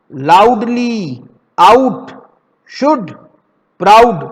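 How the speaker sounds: लाउडली drawn out slowly, then आउट called out loud; slow word demo. Shown as a demonstration. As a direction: model each word for pupils. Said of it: "next है हमारा". (धीमे और ज़ोर से उच्चारण)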